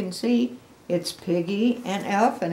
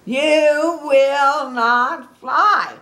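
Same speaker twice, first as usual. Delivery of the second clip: slow, drawn-out, higher-pitched holler; really loud yell; 8 semitones higher, 9 dB louder, much slower than usual. Acted. Gerald yells at Piggy, loud and drawn out. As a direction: bellow each word long and loud. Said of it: "Gerald yells really loud at Piggy".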